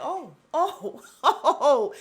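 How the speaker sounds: laughing, shocked oohs; scandalized oh. Retold or imitated. Vlogger retells her shock at a revelation, scandalized. Reacting aloud to juicy revelation.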